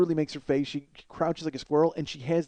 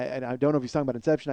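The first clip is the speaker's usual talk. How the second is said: normal talking voice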